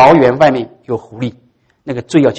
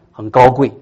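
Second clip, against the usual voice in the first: usual speaking voice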